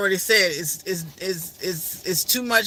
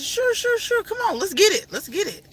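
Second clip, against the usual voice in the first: delighted yes; 12 semitones higher than usual. Retold or imitated. Agreeing to hug, laughing.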